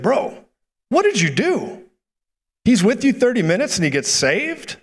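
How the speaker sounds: high-pitched imitation of a stunned friend; mock astonished phone reaction; acted disbelief.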